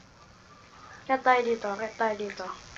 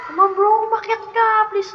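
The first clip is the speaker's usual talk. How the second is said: urgent pleading; 8 semitones higher, 7 dB louder, faster than usual. Real, not acted. Urging teammate to climb.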